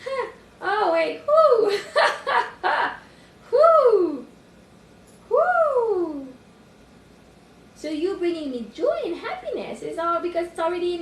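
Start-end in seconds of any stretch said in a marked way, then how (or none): 1.3-6.3 high, loud falling whoops; elated whoop. Real, not acted. Speaker celebrating an inner realisation, whooping loudly. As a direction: let out big celebratory whoops.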